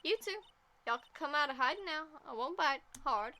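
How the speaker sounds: teasing southern drawl, starting high; playful southern voice. Acted. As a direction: coax them out with a southern twang. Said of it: "I like speaking in a southern drawl".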